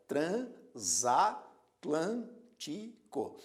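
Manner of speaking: "Transatlântico" drawn out very slowly as tran-sa-tlân-ti-co; split into syllables. Shown as a demonstration. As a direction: spell out "Transatlântico" slowly, one syllable at a time. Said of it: "Divisão silábica". ("Transatlântico" bem devagar, sílaba por sílaba)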